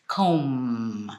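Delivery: COMB drawn out low on a humming M; low, lingering COMB; voiced ending. Shown as a demonstration. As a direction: hold the voiced M at the end of "comb". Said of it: "You're using your voice".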